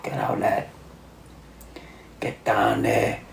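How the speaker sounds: whispered, straining urge; strained whisper. Pretend. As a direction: push it out under your breath. Urging the turd out.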